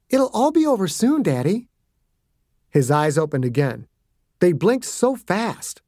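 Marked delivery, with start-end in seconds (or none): 0.1-1.7 put-on a little girl soothing; sweet child voice. A narrator's reading.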